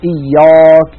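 إياك with a wrongly dark, heavy alif; mock the foreign heavy إياك. Shown as a demonstration. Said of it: "كما يفعل بعض إخواننا الأعاجم". (نطق خاطئ مفخم)

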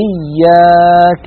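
إياك with a thin, light alif. Shown as a demonstration. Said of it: "يعني إياك نعبد". (ألف مرققة)

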